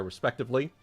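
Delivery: ordinary talk, nothing marked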